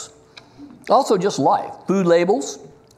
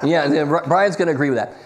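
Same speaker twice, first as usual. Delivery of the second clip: normal talking voice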